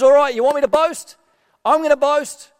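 mock-defiant concession; exasperated giving in. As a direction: throw up your hands and give in. [name] finally gives in.

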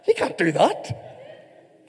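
drawn-out protest with a laugh in it; half-laughing, incredulous objection, stretched out; mock protest. Dad objecting to a dodged answer in a game, mock protest.

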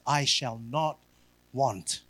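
low and soft, a pause before want; soft, deliberate point. Acted. Preacher to congregation, soft and deliberate. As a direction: set the point down quietly. Announces his final point.

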